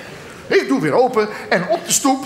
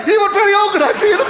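excited shout; 10 semitones higher than usual. Acted. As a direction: shout it out like spotting a celebrity. Repeated louder, much higher.

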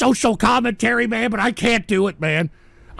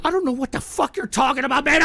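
normal talking voice

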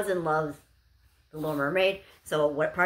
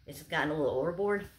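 ordinary delivery

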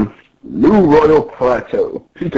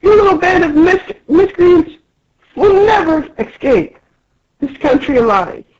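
squeaky Pika threat. Acted. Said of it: "And Pika's like".

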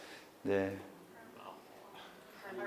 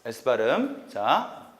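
usual speaking voice

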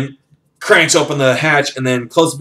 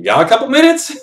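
acted question shooting way up in pitch; high-pitched question; 14 semitones higher, 4 dB louder than usual. Friend asking Jack for a moment, high-pitched question. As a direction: lift it very high as a quoted ask.

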